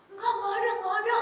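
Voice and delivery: high-pitched innocent denial; acted false denial. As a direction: play a child caught stealing and denying it.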